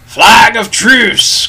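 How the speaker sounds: loud, high, drawn-out call; Long John Silver crying out. A narrator's reading. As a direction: call it slowly across a distance. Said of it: "cried Silver".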